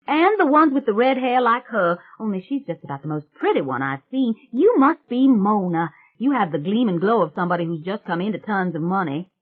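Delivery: put-on loud, high, brash country girl; a loud, high swamp witch bragging to the family. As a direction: belt it out like a cocky backwoods girl. Brash voice continues.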